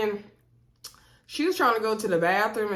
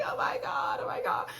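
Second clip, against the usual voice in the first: mock sob; 3 dB quieter than usual. Mock crying continues.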